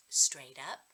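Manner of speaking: plain reading voice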